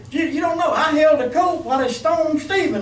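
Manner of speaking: high, loud preaching as Paul; impassioned apostle voice; Paul voiced, loud. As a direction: cry it out as Paul. Voicing Paul in sermon.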